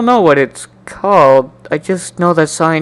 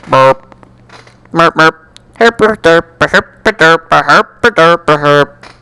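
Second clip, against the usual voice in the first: silly herp derp; much slower than usual. Acted. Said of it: "Sorry, got bored there".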